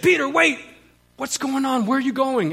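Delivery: high, urgent plea; alarmed calling out. Retold or imitated. Sister chasing her brother out on the sidewalk, alarmed calling out.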